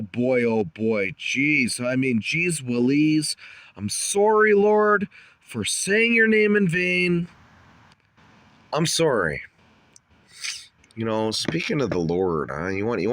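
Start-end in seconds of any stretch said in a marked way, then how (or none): 3.8-9.4 apologizing. Real, not acted. Apologizing to the Lord.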